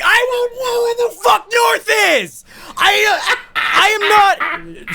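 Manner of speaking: very high, loud, slow-stretched yelling; frantic scream. Retold or imitated. Lost man screams back at Schindler, frantic. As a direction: scream back in helpless frustration.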